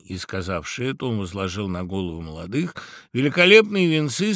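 usual speaking voice

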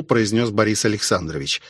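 plain reading voice

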